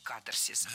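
катарсис as КА-тар-сис, low and falling; stress on first syllable. Shown as a demonstration. Teacher asks listeners to memorise, first-syllable stress. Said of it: "ударение на первом слоге".

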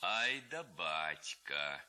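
slow, marvelling low exclamation; amazed chuckle. A narrator's reading. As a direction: savour the discovery slowly. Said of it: "говорил Левко". (Левко медленно и изумлённо)

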